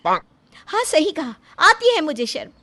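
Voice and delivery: high, loud, defiant outburst; angry admission. Acted. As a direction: throw the shame back at them. Wife angrily admits being ashamed.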